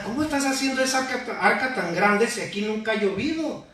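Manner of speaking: high, quick, laughing mock heckle; mock jeering. Crowd questions Noah's huge ark, mock jeering. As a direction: mimic scoffers ridiculing someone in a high voice. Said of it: "toda la gente le gritaba".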